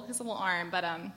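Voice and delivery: ordinary talk, nothing marked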